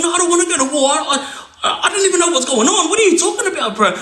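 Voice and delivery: high, fast frightened protest; mock-scared pleading; imitated terrified denial; panicked denial. Scared man denying.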